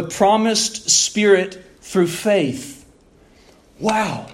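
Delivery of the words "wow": usual speaking voice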